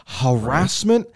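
usual speaking voice

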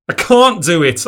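loud, high anguished scream. A narrator's reading. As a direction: scream it out, at breaking point. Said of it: "I screamed".

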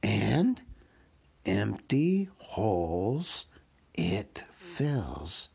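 very slow, drawn-out creature voice; Gollum posing a riddle. Acted. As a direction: draw each phrase out like an eerie riddle.

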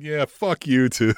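taunting, slow and deliberate; slow mock retort. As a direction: drag out the insult slowly.

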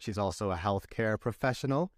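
ordinary talk

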